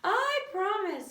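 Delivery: high, slow promising little voice; pigtail voice. A narrator's reading. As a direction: swear it sweetly. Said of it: "said the pigtail".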